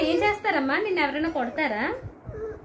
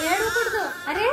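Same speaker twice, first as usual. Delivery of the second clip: laughing coax; 6 semitones higher, slower than usual. Real, not acted. Mother laughs at crying child.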